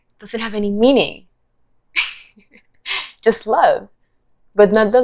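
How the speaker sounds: laughing through the words; amused laughter. Real, not acted. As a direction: let a real laugh spill into the words. Laughs amid own talk.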